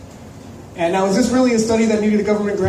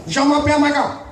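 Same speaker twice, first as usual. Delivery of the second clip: put-on high-pitched French in a Chinese accent; mock Chinese accent; 6 semitones higher than usual. Student introducing himself in French class, mock Chinese accent. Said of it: "I learned French from a Chinese lady".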